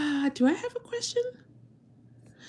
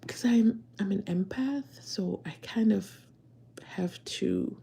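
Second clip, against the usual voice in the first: hushed whisper; confiding whisper to viewers; 7 dB quieter than usual. Real, not acted. Whispering aside about being empath.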